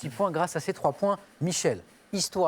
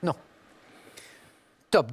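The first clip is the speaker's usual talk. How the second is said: ordinary talk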